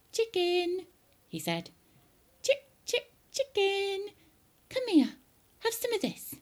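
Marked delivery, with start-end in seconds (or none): 0.1-0.9 put-on high, singsong boy's call; high-pitched animal coaxing; coaxing a hen. 2.4-6.4 put-on very high, cajoling boy; high-pitched, wheedling George; coaxing a hen.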